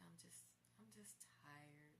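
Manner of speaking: a quiet, slow, teary sigh of words; tired and tearful. Retold or imitated. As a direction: barely get it out, drained. In tears answering him.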